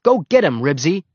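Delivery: loud, high cheer starting strong; yell. A narrator's reading. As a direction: yell your dog on. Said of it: "he would have yelled".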